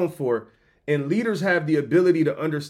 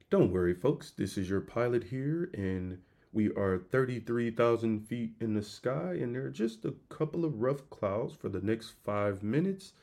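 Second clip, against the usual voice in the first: low, soft, steady captain voice; calm pilot announcement; 4 semitones lower, 10 dB quieter than usual. Retold or imitated. Pilot reassuring passengers over intercom, low soft calm voice. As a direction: soothe the cabin like an unbothered captain. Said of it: "you sound cool".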